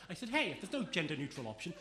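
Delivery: ordinary delivery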